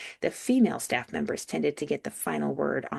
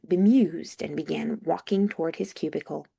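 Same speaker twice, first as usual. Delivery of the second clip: usual speaking voice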